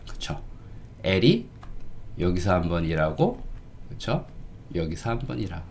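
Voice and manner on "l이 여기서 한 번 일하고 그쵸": ordinary talk, nothing marked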